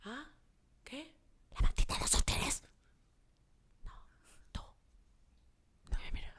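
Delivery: barely audible whisper with long pauses; imitated speechless man. Driver turning to his partner, mock stunned whisper. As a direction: mouth it in stunned, nearly silent fragments. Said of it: "mudo, voltea y me dice".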